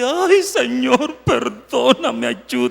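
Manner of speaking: high, slow, whiny mock plea; exaggerated sorrowful pleading; mock sad prayer. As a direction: play the sad little begging voice. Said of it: "con la cara toda triste".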